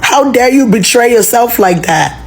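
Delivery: normal talking voice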